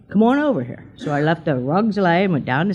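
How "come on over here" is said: a light, higher coaxing call; beckoning call. Retold or imitated. A mysterious voice calling her to the cemetery, higher and coaxing. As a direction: lure her over gently, like a spirit calling. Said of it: "Seriously, just like".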